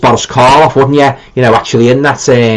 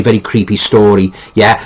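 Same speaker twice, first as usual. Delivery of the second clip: ordinary delivery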